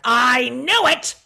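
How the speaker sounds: loud, high, drawn-out mock yell; mother's accusing outburst. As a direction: imitate a mother crying out in triumphant accusation.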